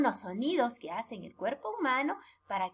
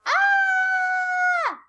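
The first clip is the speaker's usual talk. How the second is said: very high loud scream; shout; 6 dB louder than usual. Shown as a demonstration. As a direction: let out a big yell to show shouting.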